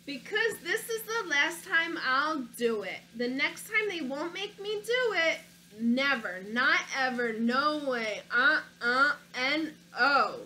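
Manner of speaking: put-on lively read-aloud for children; story narration. A narrator's reading. Reading a picture book to children, lively storybook narration. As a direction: tell it like a picture book to kids.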